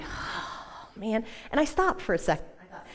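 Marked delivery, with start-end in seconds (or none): 0.0-1.2 breathy whispered "oh, man" of reluctance; whispered groan. Retold or imitated. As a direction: sigh the words out in a whisper. Groaning at the inner command.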